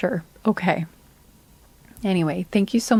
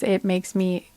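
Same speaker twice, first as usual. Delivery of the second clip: ordinary delivery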